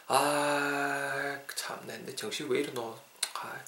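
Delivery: very soft grumbling to oneself; drawn-out sigh, then muttered self-reproach; quiet aside, annoyed at own forgetfulness; acted exasperation.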